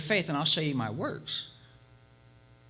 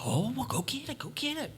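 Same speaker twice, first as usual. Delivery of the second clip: eager urging; 8 semitones higher, 4 dB quieter than usual. Acted. Villagers urge leader eagerly.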